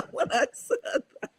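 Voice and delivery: words breaking into real giggles; laughing. Real, not acted. Co-host amused by her own mixed nuts idea, laughing. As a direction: say it through a laugh, pitch lifted. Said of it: "I'm just laughing".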